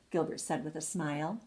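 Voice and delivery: plain reading voice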